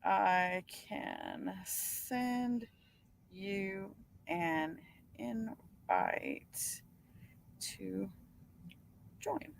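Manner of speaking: very slow and soft, word by word; hushed, halting read-aloud with long gaps. Real, not acted. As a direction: draw out each word quietly as if typing it. Reading out words while typing.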